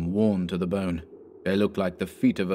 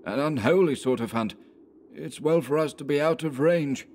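higher voice for the uneasy companion; wary woodsman, voice pitched up; Anderson, uneasy; 7 semitones higher than usual. A narrator's reading.

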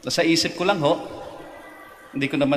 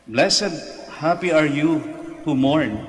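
raised, ringing proclamation; proclaiming scripture; 4 semitones higher, slower than usual. Acted. Priest reading the Beatitudes aloud to the faithful, raised. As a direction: lift the blessing up over the pews.

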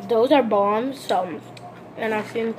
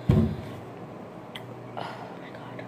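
whispered; 9 dB quieter, slower than usual. Real, not acted. Muttered exclamation.

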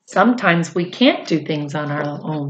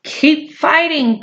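calling out; 8 semitones higher, slower than usual. Acted. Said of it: "called Dilly".

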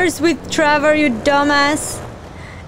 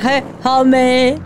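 funny Trevor voice; 4 semitones lower than usual. Retold or imitated. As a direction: lower mock "homie" greeting. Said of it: "in a funny way".

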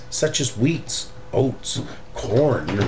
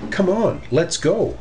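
higher, bright call to get going; eager rabbit; 7 semitones higher than usual. Acted. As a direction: cheer the others on to work. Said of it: "The third little rabbit said".

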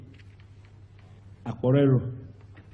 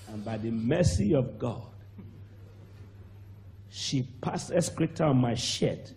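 ordinary delivery

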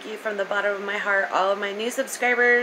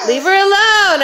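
louder and higher, shooing; loud leave her alone; 10 semitones higher, 10 dB louder than usual. Real, not acted. Telling him to leave the little girl alone, loud call. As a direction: call out sharply to shoo him off. Shooing someone away from kid.

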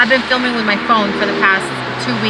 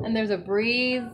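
ordinary talk, nothing marked

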